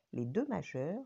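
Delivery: ordinary delivery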